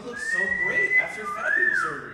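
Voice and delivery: very high, loud squeal; squeaky voice. Acted. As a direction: squeal with amazement. Squeaky amazed compliment.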